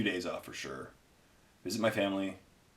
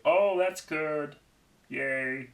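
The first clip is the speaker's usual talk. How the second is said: higher, slow goofy friend voice; slow, pleased, pitched-up Andy; Andy impression; 5 semitones higher, much slower than usual. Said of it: "That's basically Andy".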